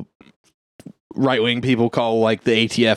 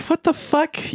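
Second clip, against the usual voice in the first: shocked; slower than usual. Retold or imitated. Cops react to confession.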